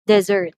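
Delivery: "dessert" with a loud, long SSERT; second-syllable stress. Shown as a demonstration. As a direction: lean on and lengthen the end of "dessert". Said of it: "the second syllable receives the stress".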